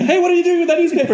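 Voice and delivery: very high, loud alarmed question; mock-alarmed spider. Spider to human holding a newspaper, high mock alarm. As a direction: squeak it out, suddenly worried about being swatted.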